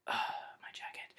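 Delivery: a sigh, then My jacket whispered slow and soft. Pretend. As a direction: breathe it out like a lament for the lost jacket. Mourning his stolen jacket.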